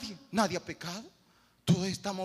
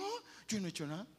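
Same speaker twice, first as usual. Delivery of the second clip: mock denial; 8 dB quieter, much faster than usual.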